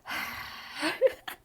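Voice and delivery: a big heavy sigh. Shown as a demonstration. Showing viewers the sigh she just did, heavy sigh. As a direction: let out a long exhausted sigh. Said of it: "I just sighed, like".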